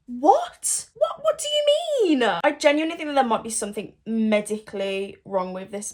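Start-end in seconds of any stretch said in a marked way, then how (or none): 0.1-2.2 high, loud disbelieving cry; shocked disbelief. Real, not acted. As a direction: squeal it in disbelief. Reacting to Curtis's line.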